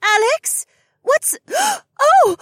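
very high, loud, breaking off; startled gasp. Acted. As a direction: gasp in shock mid-question. Shay sees the commotion.

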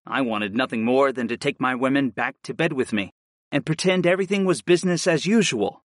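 ordinary delivery, nothing marked

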